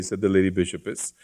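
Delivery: usual speaking voice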